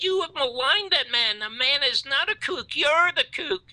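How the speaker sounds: high, indignant retort, acted out; retold scream. Defending Garrison to the producer, high retold scream. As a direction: re-enact a furious telling-off, pitch held way up. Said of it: "I scream at him".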